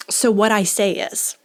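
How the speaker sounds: usual speaking voice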